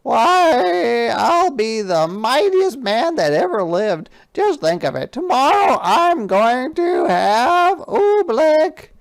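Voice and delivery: high, loud boastful king; excited gloating king. Acted. King boasts to Bartholomew, high and gleeful. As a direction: crow over the coming oobleck.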